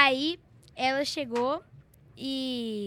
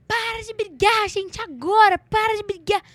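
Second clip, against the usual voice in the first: loud, high plea to stop; urgent mock yell; 6 semitones higher, 7 dB louder than usual. Little cousin runs in on the noisy family, urgent yell. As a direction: cry out like a child breaking up a fight.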